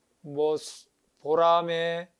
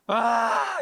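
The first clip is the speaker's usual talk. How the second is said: elephant's wailing cry; 5 semitones higher than usual. Retold or imitated. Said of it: "이러면서 지금 뒤집어졌잖아".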